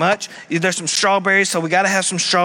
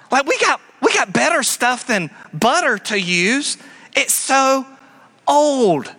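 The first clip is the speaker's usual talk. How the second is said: high, sneering mock voice, much higher; mock modern skeptic; 8 semitones higher than usual. Preacher mocking a deconstructionist to his congregation, sneering high voice. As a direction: lampoon the know-it-all who calls butter outdated.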